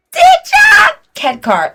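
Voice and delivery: high, loud, slow drawn-out name call; announcer intro. Real, not acted. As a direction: announce the guest like a game show host. Announcing the guest.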